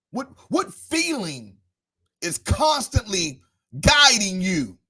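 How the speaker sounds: rising, intoned sermon call over the organ; preaching. Acted. Preacher calling out the congregation, intoned preaching.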